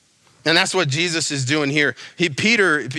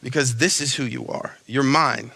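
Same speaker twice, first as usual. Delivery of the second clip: tender reassurance; 3 semitones lower than usual. Retold or imitated. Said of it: "He just says".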